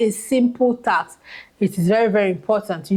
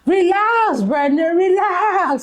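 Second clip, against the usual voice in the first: high, drawn-out meme-style cry; meme imitation; 9 semitones higher, slower than usual. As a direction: mimic the meme, stretching each Relax up high. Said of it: "Have you seen that meme?".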